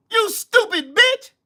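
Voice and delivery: high, slow angry jab; furious insult. Real, not acted. Cussing out a viewer.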